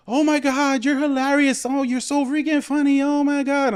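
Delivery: put-on very high, excited squealing girl voice; drunk girl yelling. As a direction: squeal it like a tipsy fan yelling from the crowd. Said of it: "she's yelling out to me".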